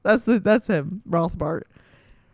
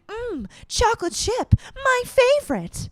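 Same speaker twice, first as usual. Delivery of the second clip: high-pitched dainty princess; 13 semitones higher than usual. Acted. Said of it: "very daintily".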